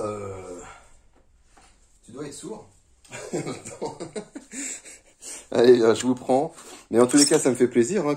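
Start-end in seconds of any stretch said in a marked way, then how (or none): 3.1-4.9 short laugh. Real, not acted. Laughs after joke about deafness.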